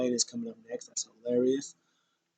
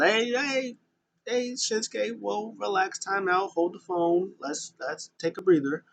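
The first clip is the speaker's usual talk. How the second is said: higher, pleading hype-man voice; mock Seth Rollins voice; 8 semitones higher than usual. Seth Rollins pleading with Shinsuke to relax, higher voice. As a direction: play the wrestler calming his rival down.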